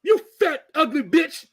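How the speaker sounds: loud, high mocking rant; mock yelling comedian. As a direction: shout it like a roast. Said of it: "when he does the women".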